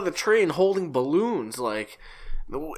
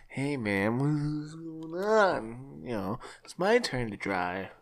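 spaced-out cart handler; 11 dB quieter, slower than usual. Retold or imitated. Meandering cart handler to his partner, soft dazed drawl. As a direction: mumble it slowly like a dazed handler. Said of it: "as one of the cart handlers".

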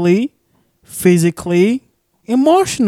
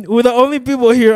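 usual speaking voice

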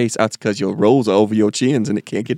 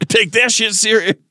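defiant shout; 11 semitones higher, slower than usual. Pretend. Throwing the phone away.

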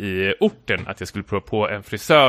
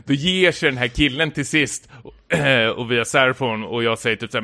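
normal talking voice

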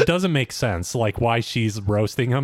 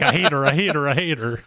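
imitated petulant rant, repeated in a rush; lightly hammed-up complaint; mock whining hatred; 4 semitones higher, faster than usual. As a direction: play up someone else's exasperation. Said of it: "And Vinny's like".

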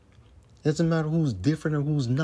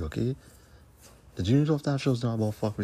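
ordinary delivery

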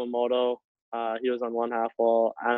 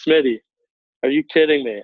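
louder, exasperated "are you kidding me"; coach's angry call-out; 3 semitones higher, 5 dB louder than usual. Retold or imitated. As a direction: call him out like a furious coach. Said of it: "and he's so pissed".

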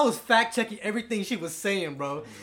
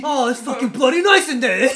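mock British; 5 semitones higher, 10 dB louder than usual. British porn parody.

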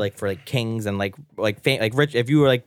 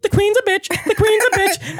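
very high squawky parrot voice; shrieky bird mimicry; gossiping parrot. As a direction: repeat it like a tattling bird.

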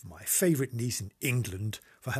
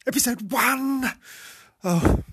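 high, loud, slow announcer burst; over-the-top game show announcement; too excited; 10 semitones higher, 8 dB louder, slower than usual. Real, not acted. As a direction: belt out the episode number like a fanfare. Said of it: "Too excited".

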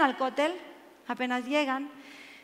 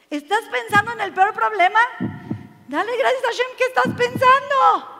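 loud, raised-pitch preaching in a driving run; fervent exhortation; 7 semitones higher, 9 dB louder than usual. Real, not acted. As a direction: urge gratitude on the congregation with full force. Exhorting the congregation. (exhortación ferviente en voz alta)

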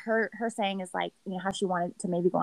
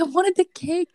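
high, loud, petulant imitation of a guy who wanted cake; whiny mock complaint; 9 semitones higher, 6 dB louder than usual. Friend mimics Riley upset he missed the cake, whiny. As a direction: whine it like a kid denied dessert.

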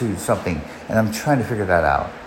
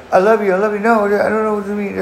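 much higher, louder mock wail of a girlfriend; imitating crying girlfriend; 11 semitones higher, 3 dB louder, faster than usual. Girlfriend clinging and crying to keep him, high loud mock wail. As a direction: ham up her desperate crying plea. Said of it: "she grabs me and starts crying".

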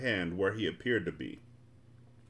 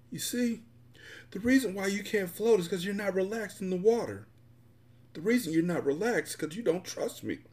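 higher-pitched character voice, gently coaxing; patient, reassuring boyfriend; 10 semitones higher than usual. Acted. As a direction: coax a nervous partner to trust you. Bullet patiently reassures her.

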